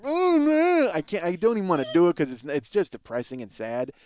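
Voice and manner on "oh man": high, loud drawn-out moan; mock moan. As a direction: imitate a long, high wailing moan. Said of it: "then she's just like".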